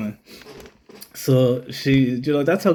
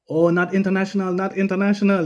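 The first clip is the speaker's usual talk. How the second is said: higher, repeated in imitated Thai-English; mock Thai cop; 7 semitones higher than usual. Cop inspecting the out-of-date license, imitated Thai accent. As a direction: mimic the officer shaking his head over it. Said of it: "he said to me".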